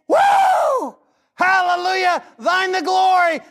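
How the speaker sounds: high whooping hallelujah; jubilant shout of praise. Pretend. Worshipper celebrating God's glory with a high shout. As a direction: burst out in joyful praise.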